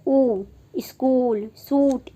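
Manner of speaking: ऊ drawn out slowly, then स्कूल and सूट with a long oo; long oo demo. Shown as a demonstration. Teacher shows students the long oo vowel. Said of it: "इसको किस तरह pronounce करेंगे".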